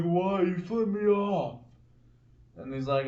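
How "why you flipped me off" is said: higher-pitched imitated protest; mock outrage, pitched up; indignant. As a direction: protest like an aggrieved rival in a retelling. Rival protests in retold quarrel.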